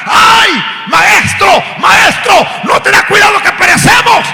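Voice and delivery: high, loud cry for help; terrified shouting. Retold or imitated. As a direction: scream to the master as if drowning. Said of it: "comenzaron a gritar".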